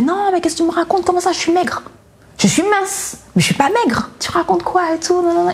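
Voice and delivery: voix aiguë, indignée et rapide; énervée. Retold or imitated. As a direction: protester avec agacement contre la mère. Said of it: "Et je m'énervais". (se défendre, vexée, d'une voix plus aiguë)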